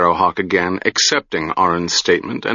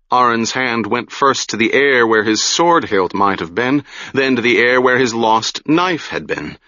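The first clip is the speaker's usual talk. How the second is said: ordinary delivery, nothing marked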